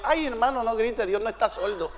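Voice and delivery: soft, low shushing of a shouter; hushed rebuke. Retold or imitated. Church sister hushes the shouting visitor, soft retold rebuke. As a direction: imitate a church sister's quiet scolding.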